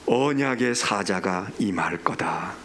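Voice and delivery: low, very slow scripture quotation; grave, unhurried pronouncement; solemn pronouncement. Retold or imitated. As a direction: intone it low and drawn out, like a divine decree. Quotes God's solemn pronouncement in scripture.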